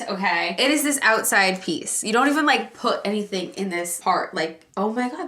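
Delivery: ordinary talk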